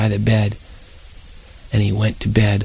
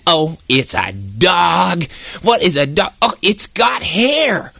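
fussy aunt disgust; 4 semitones higher, slower than usual. Acted. Aunt Edith disgusted by dog.

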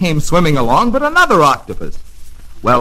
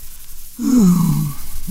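sad sigh; 5 semitones higher, 4 dB quieter than usual. Acted. Octopus wishes for instruments.

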